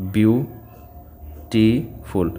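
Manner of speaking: Beautiful drawn out very slowly, syllable by syllable; slow syllable split. Shown as a demonstration. Teacher showing students the three syllables in beautiful, very slow. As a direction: stretch beautiful so each of its three syllables is heard. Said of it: "तो तीन syllable है".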